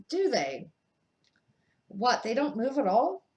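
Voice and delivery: zdumiony Ron, wyżej i głośniej; amazed. A narrator's reading. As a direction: ask it in disbelief. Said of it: "Ron sounded amazed".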